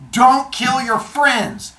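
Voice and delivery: slow, loud, high proclamation; proclaimed moral. Acted. Old man giving story's moral, slow loud proclamation. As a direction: hammer each word out slowly.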